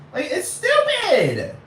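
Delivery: high, drawn-out squeal of exasperation; exasperated squeal. Real, not acted. Telling them it's not entertainment, exasperated high squeal. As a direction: stretch it out high in disbelief. Exasperated escalation of "that's just stupid.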